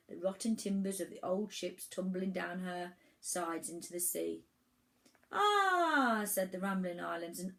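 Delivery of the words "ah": high, loud drawn-out ahh; crowd's loud cry of wonder; awed "ah". A narrator's reading. Crowd of islands exclaims in awe.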